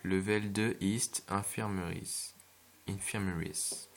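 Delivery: slow English sign read with a thick French accent. Shown as a demonstration. Gamer reading an English sign in a French accent. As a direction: sound out "Level deux East Infirmaries" the French way, every syllable. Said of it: "me tirer une balle pour mon accent".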